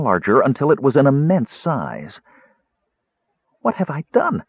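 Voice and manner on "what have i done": high, alarmed question. A narrator's reading. Kender startled by what the ring did, alarmed. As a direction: voice jumps up in sudden alarm. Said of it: "Tass wondered in alarm".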